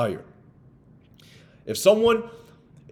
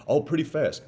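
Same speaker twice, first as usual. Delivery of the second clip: ordinary delivery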